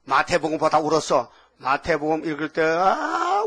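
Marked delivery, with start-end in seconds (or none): 2.6-3.4 long, high rising "ah" of heartbreak; acted wail. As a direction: let out a drawn-out high moan. Tearful retelling of reading Matthew. (길게 끌어올린 탄식 소리)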